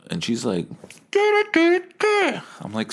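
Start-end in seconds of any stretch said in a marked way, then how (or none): 1.1-2.4 very high, garbled megaphone squawk; megaphone gibberish. Retold or imitated. Megaphone girl repeating herself unintelligibly, mock squawk. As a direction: imitate unintelligible shouting through a megaphone. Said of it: "girl with the megaphone was just screaming".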